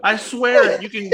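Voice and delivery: the woman swears to the cop; pleading woman. Retold or imitated. Voicing the woman's plea.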